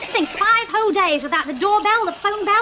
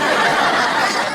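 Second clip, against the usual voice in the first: a louder breathy burst of laughter; 2 semitones lower, 5 dB louder than usual. Real, not acted. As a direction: burst out laughing at your own joke. Mum laughs at her own joke.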